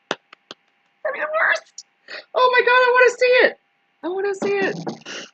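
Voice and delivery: very high squeaky mock delight; mock squeal. Streamer squeals with mock glee about a Ukraine face mask. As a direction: squeal it like a giddy kid.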